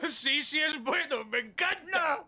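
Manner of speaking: high, slow, tearful pleading; begging through tears; weeping. Acted. Son weeps, begging father.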